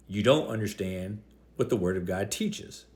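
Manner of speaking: ordinary talk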